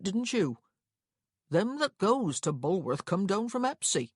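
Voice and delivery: unhelpful but chatty clerk voice; talkative clerk. Acted. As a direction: play a clerk dispensing local advice.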